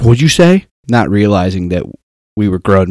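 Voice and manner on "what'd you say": high, loud mock-tough "What'd you say?"; teen challenging. Kid confronting Cap after the movie, high loud mock challenge. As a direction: imitate a cocky kid squaring up. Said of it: "and he's like".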